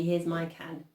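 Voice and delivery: ordinary delivery, nothing marked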